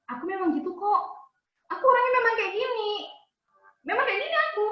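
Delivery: defensive whine. Retold or imitated. Reactive person excusing himself, defensive whine. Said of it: "Orang reaktif bilang".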